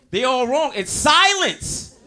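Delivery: high, slow, laughing reveal; slow, high, amused punchline; drawn-out punchline. Acted. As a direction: stretch the word silence out for the laugh.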